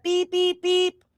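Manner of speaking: high-pitched mock reversing beeps; reversing truck beeps. Telling her co-host to back up, high mock truck beeps. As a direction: beep like a truck backing up.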